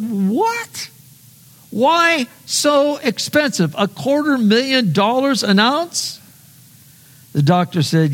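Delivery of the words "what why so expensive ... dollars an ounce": shocked. Acted. Customer shocked at price.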